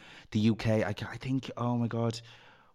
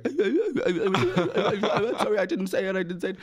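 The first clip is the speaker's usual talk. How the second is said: much higher, louder whiny backpedal with a laugh; panicked apology; 11 semitones higher, 3 dB louder than usual. Retold or imitated. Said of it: "she was like".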